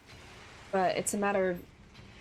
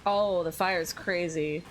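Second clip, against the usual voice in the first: normal talking voice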